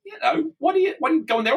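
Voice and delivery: high, hesitant questioning; mock-nervous, halting high voice; nervous policeman. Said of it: "a little nervous".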